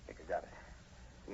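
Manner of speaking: slow, breathless whisper; tense whisper. Acted. Sergeant whispering after firing into the bushes. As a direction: whisper it, afraid to be heard.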